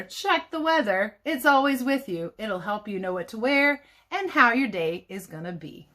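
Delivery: ordinary talk, nothing marked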